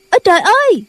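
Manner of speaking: put-on a girl yelps as she falls; startled cry. A narrator's reading. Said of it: "la lên".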